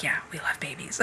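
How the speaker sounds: soft unvoiced whisper, dazed; whispered echo. Echoing her husband's reassurance on the call, whispered. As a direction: breathe the words back in a whisper.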